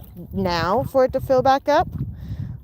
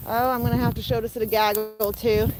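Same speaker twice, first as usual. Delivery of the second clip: ordinary delivery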